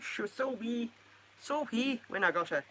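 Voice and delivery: lightly put-on Scottish lilt; a touch of mock Scots; Scottish accent. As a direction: slip playfully into a Scottish accent. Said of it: "I'm allowed to do a Scottish accent".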